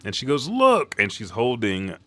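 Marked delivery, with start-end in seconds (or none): 0.5-1.0 high, loud attention call; acted eager exclamation; excited showing-off. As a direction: urge them to come and see. Acting her showing off her find.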